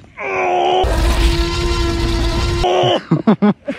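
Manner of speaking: loud high laughter. Real, not acted. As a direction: burst out laughing. Lifting friend into trolley.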